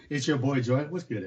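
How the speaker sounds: ordinary talk, nothing marked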